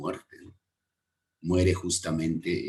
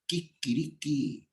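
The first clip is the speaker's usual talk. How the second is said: slow, higher "Kikiriki" crowing like a rooster; rooster call kikiriki; 8 semitones higher, 5 dB quieter, slower than usual. Shown as a demonstration. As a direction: sing out "Kikiriki" like a gallo. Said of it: "lo conocemos como el kikiriki". (canto de gallo "Kikiriki", lento y agudo)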